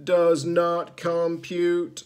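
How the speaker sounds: slow, loud, mechanical robot monotone. Acted. Rusty old robots answering Zed, slow loud robotic monotone. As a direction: intone it like an old machine. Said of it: "replied the rusty old robots".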